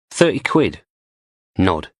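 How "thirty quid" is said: posh English woman. A narrator's reading. Blondie names the price.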